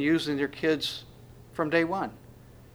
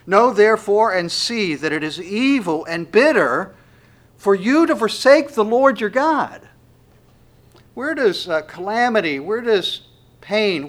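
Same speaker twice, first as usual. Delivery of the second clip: loud, high, impassioned scripture reading; preaching at full force; 6 semitones higher, 8 dB louder than usual. Acted. Preacher to his congregation, loud impassioned preaching.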